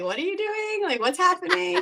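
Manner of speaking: high, puzzled mock question; baffled relatives. Family puzzled by her YouTube.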